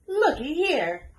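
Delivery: high, loud, slow call-out; shouted call. A narrator's reading. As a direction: call out to the whole kitchen. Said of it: "shouted one of the cooks".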